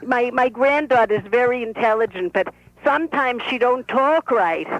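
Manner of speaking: put-on lively old Brooklyn bubby; Bubby's voice. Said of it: "This is the Bobby talking".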